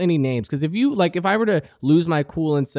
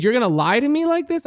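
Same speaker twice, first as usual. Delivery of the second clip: high-pitched mock indignation; indignant challenge; 9 semitones higher, faster than usual. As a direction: imitate an offended woman challenging a liar. Acted accuser in heated argument.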